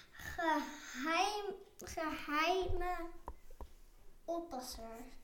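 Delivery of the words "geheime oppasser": ordinary delivery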